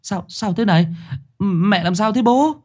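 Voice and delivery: high, startled stammer; stammering son. A narrator's reading. Son finds his mother collapsed and asks his father, stammering high. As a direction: trip over the words in alarm. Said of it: "nó lắp bắp".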